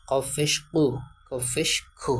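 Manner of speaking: ordinary talk, nothing marked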